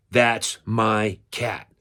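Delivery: slow, word-by-word claim; emphatic declaration. Retold or imitated. Adopter choosing the skinny kitten at the pound, emphatic declaration. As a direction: spell it out slowly and decisively.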